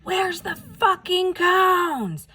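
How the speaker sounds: high, slow furious yell at a passing driver; retold outraged yell; angry yell. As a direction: shout it through his closed window, drawn out. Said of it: "I just yell".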